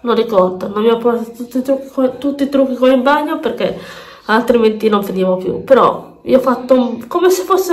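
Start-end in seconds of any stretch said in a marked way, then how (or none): none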